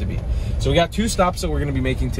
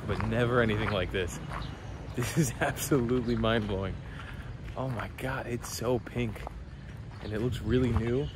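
quiet; 2 semitones lower, 11 dB quieter than usual. Real, not acted. Talking softly inside mosque grounds.

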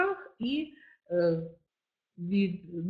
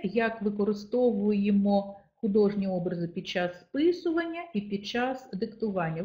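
usual speaking voice

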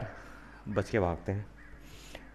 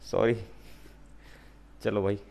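normal talking voice

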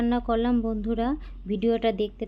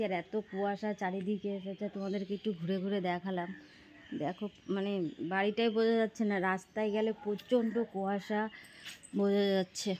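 while brushing teeth; 7 dB quieter than usual. Real, not acted. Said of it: "আমি এখন brush করছি".

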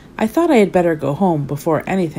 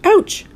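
high loud yelp of pain; Mister Silly kicked on the shin, yelping; shouted ouch; 13 semitones higher, 5 dB louder than usual. A narrator's reading. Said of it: "shouted Mister Silly".

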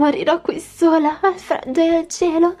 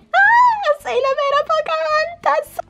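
much higher, squealing with joy; delighted surprise; 11 semitones higher, slower than usual. Acted. Princess thrilled to see the real Pocahontas back. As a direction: gasp with glee at the discovery.

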